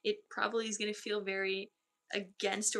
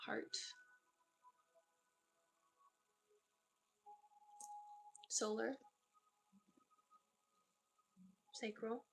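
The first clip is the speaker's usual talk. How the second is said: guided body scan. Acted. Medium closing the session for the client, calm guided meditation. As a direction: guide them down the body calmly.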